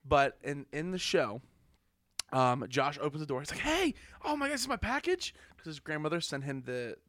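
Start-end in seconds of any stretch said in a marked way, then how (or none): 3.6-5.3 high-pitched excited greeting, imitated; mock excitement. Retelling Josh opening the door to a package, mock excited. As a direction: act out an eager TV character at the door.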